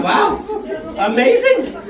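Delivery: high, wide-eyed mock wonder; mock amazement. Acted amazed reply to Leo.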